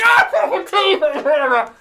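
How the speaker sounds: high, slow pained cry; pained yell. Acted. Orc howling after a radish knocks out his tooth. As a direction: howl over the lost tooth. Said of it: "he's just like".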